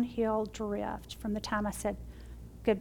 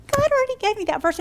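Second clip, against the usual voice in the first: high, loud and fast burst of joy; excited exclaim; 11 semitones higher, 9 dB louder, faster than usual. Retold or imitated. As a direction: blurt it out thrilled, racing. Excited retelling of shared verse.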